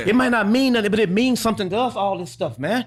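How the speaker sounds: earnest, heated venting; impassioned serious rant. Real, not acted. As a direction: plead your case, voice riding high. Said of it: "My man's serious about this".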